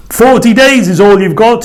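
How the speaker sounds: high, loud stern warning. A narrator's reading. Jonah warning the people of Nineveh, loud and stern. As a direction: warn the whole city sternly. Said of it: "he warned them".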